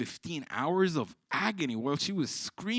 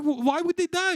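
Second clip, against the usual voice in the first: alarmed question; 5 dB louder than usual. Retold or imitated. Alarmed teacher asks girl.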